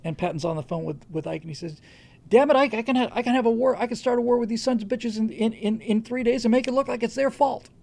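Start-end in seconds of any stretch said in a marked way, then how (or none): 2.3-7.7 raised, higher, louder shout; mock Patton yell. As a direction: bark it like an angry general on the phone. Patton yelling at Ike, retold.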